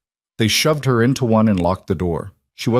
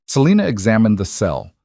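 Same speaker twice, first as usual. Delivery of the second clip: ordinary delivery, nothing marked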